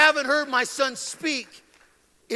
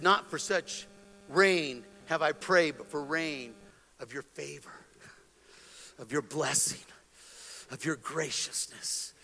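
reverent prayer; 8 semitones lower, 6 dB quieter than usual. Retold or imitated. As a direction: pray softly, pausing before each blessing. Retold solemn prayer for gentle rain.

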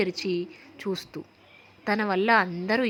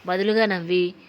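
ordinary delivery, nothing marked

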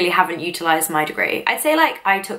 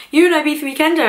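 excited stranger; 9 semitones higher, 4 dB louder, faster than usual. Retold or imitated. Boy recognising her on holiday.

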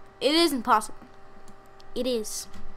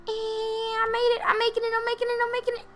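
strained high voice; 9 semitones higher than usual. Real, not acted. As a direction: squeak it out while straining. Struggling to reach the goal.

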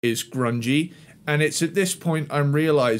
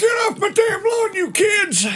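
put-on much higher, shouted cranky-old-man yell; grumpy old man yelling. Mocking the band as old men, a mock cranky old-man shout. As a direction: bark it like a geezer chasing kids off his lawn. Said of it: "old grumpy man yelling at a cloud".

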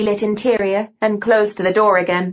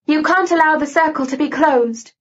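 higher-pitched, mildly urgent plea; 6 semitones higher than usual. A narrator's reading. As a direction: press the warning home, voice raised in pitch. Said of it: "said Lucy urgently".

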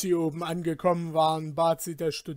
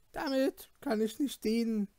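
grim; 7 semitones higher than usual. Acted. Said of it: "entgegnete sie finster".

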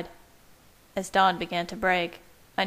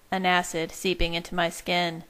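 plain reading voice

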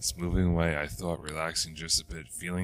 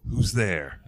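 loud, higher shout trying to sound brave; 5 semitones higher, 9 dB louder than usual. A narrator's reading. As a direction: bark it into the dark to hide the fear. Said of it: "I shouted".

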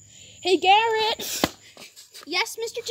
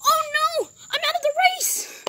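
higher, near-tearful cry of dismay; upset wail; 8 semitones higher than usual. Pretend. Crashed driver wails to himself, high and upset. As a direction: whimper it like a kid who just lost.

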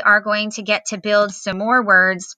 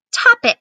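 "topic" sung out high and louder; dictated word; 5 dB louder than usual. Shown as a demonstration. Teacher announcing the next spelling word "topic", high and louder. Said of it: "the second word that I want to go over with you is".

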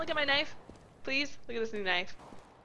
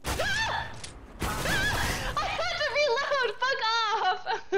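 frustrated yelp; 8 semitones higher, much slower than usual. Real, not acted. Dies while reloading.

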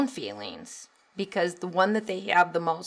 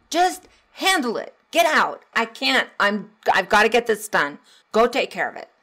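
higher, louder impatient yell; busy parent yelling; 3 semitones higher, 6 dB louder than usual. Retold or imitated. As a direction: snap at the kid while busy.

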